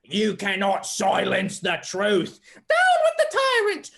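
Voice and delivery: loud, drawn-out puppet proclamation leaping very high; rally the tea party as a defiant puppet; shrieking revolutionary puppet breaking into anthem; rebellious puppet. Acted. Puppet revolt, sings protest song.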